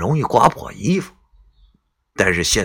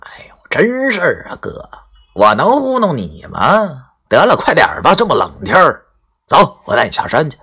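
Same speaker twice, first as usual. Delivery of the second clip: eager pleading pushed higher; somewhat urgent; 6 semitones higher than usual. Acted. Qiao urges grandpa to come down the mountain, eager and pressing. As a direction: hurry him along, coaxing. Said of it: "又有些迫切地说道".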